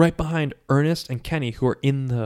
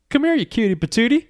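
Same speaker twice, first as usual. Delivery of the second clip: put-on higher, cooing baby talk; 12 semitones higher, 4 dB louder than usual. [name] grinning as he grabs the troll, cooing. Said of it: "smiles at the troll".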